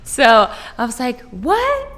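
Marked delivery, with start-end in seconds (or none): 1.3-1.9 high, loud retold protest; hammed-up incredulous objection; outraged disbelief. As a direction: plead your case, high and indignant. Acts out her outrage at rejection.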